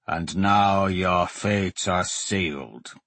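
slow, low menacing doom voice; mock threat, drawn out slowly; ominous. Looming monk teases with doom.

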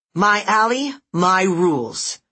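put-on high, nasal, bossy little girl. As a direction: chide them like a playmate. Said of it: "Her bossy nasal voice".

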